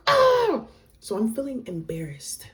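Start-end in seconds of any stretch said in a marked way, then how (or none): 0.1-0.6 loud, very high scream; overwhelmed scream. Retold or imitated. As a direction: shriek at being overwhelmed. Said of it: "And I was just like".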